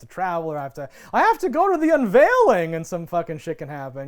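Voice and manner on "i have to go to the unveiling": high, loud fake-urgent mom; hammed-up busy mother; mock dramatic mom. Mocking busy movie mom.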